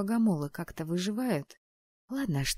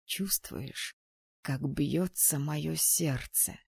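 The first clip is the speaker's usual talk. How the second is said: slow, soft and breathy; 3 semitones lower, 4 dB quieter, much slower than usual. A narrator's reading. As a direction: breathe the words out with longing. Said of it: "с придыханием спросил он".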